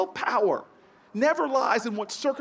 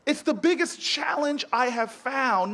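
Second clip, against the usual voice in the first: ordinary delivery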